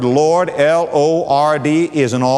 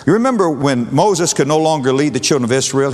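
ordinary delivery

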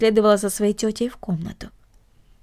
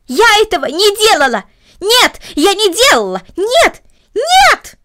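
put-on high, loud girl's denial, rising to a scream; loud shout; 11 semitones higher, 7 dB louder than usual. A narrator's reading. Girl shouting her innocence at her aunt, loud protest. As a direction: scream no at them, louder each time. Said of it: "громко закричала Корнели".

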